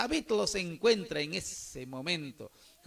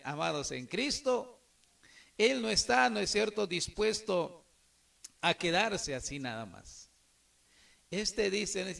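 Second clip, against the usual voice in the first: ordinary talk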